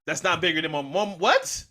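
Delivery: loud, high-pitched disbelief; can't believe what they heard; incredulous outburst. Real, not acted. Disbelief at friend's pick.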